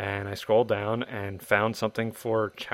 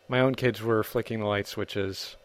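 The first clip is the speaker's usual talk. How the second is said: usual speaking voice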